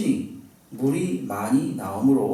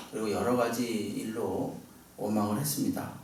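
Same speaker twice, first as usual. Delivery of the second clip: usual speaking voice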